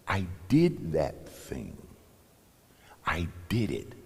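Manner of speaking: "I did it" breathed out with no voice; whispered confession. Acted. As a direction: confess it under your breath. The woman's quiet confession.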